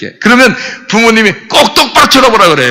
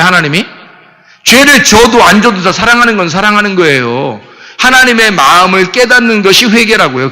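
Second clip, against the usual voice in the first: normal talking voice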